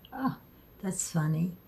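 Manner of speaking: ordinary talk, nothing marked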